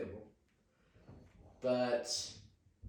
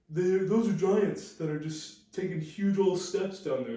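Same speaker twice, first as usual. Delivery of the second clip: loud, slow, higher put-on voice; mock believer; 4 semitones higher, 5 dB louder than usual. Mocking those who credit giants for stair steps, put-on voice. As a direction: imitate people pushing a giants theory, loudly. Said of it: "people like to say".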